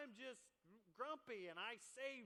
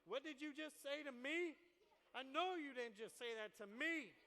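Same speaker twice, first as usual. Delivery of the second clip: louder, higher indignant challenge; offended friend; 4 semitones higher, 5 dB louder than usual. Retold or imitated. Preacher acting a friend taking offense, indignant and loud. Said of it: "you're like".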